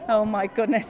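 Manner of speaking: ordinary talk, nothing marked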